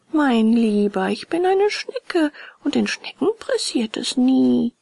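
high, stretched-out snail voice; slow, leisurely snail. A narrator's reading. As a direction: take your time, drawing the words out. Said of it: "So langsam und gemächlich wie zuvor".

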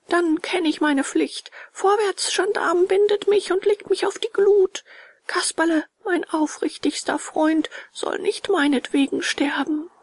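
high-pitched noble self-sacrifice; proud heroic puppet. A narrator's reading. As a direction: declaim high like a proud little hero. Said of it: "stolz richtete sich Bengele auf". (stolz und hoch, wie ein Held)

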